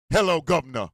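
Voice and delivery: light Cockney accent impression; lightly imitated Cockney greeting; mock Cockney. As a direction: toss off a hint of stock Cockney. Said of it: "that Cockney accent".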